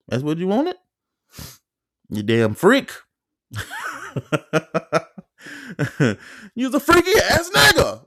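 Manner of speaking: high, loud mocking taunt; mocking jeer. To the roommate caught peeking: high loud mocking taunt. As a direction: jeer at him, pitch flying up.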